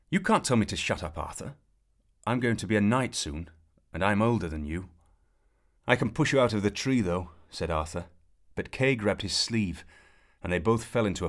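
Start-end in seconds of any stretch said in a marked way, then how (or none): 0.1-7.3 louder, higher squabbling retort; boyish quarrel. A narrator's reading. Brothers bickering in the tree, loud and indignant. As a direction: bicker back like an indignant boy.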